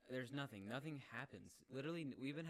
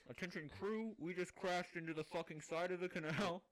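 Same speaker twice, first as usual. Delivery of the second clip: raised-pitch mock intercom address; captain's announcement; 6 semitones higher, 4 dB louder than usual. Captain telling crew of the crash, mock announcement. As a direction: announce disaster to the whole crew.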